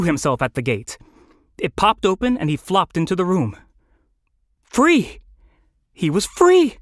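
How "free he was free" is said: high, joyful cry; exultant. A narrator's reading. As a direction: exclaim with soaring relief. Bird escapes, narrator shares joy.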